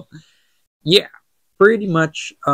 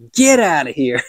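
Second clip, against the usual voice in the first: half-serious shout, a bit raised; mock dismissal yell; 3 semitones higher, 3 dB louder than usual. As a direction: playfully throw someone out. Acts out door slammed on Melkor.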